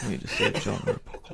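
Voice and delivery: whispered through a laugh; laughing creepy whisper. Retold or imitated. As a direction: breathe it like a horror villain, giggling. Imitating Jigsaw voice.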